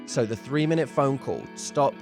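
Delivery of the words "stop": ordinary talk, nothing marked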